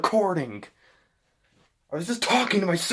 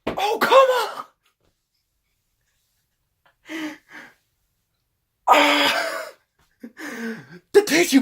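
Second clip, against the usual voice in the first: frustrated yell; 6 semitones higher, 5 dB louder than usual. Real, not acted. Frustrated at a missed throw.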